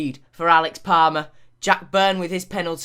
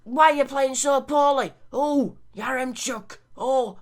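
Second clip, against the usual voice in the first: higher, pleading terrace moan at the players; exasperated Burnley fan; 8 semitones higher than usual. Acted. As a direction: yell at the lads like a fed-up Burnley fan. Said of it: "I'm sort of losing the accent".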